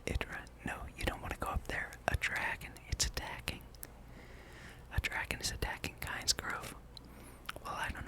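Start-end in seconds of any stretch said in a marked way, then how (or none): none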